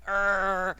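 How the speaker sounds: short guttural growl of fury; acted creature growl; monster yell. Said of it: "he just yells, and he's like".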